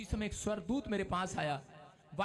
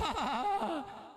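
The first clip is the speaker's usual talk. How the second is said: forced laugh; 6 semitones higher than usual. Retold or imitated. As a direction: laugh because the angel told you to. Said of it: "मैंने कहा". (आदेश पर ज़ोर की हंसी)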